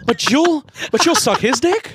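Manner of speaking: high, indignant mock complaint; a hint of a jilted date; mock outrage. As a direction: squeal it with fake indignation. Mock outraged dater quote.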